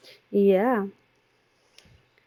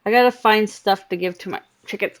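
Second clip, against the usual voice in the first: ordinary talk, nothing marked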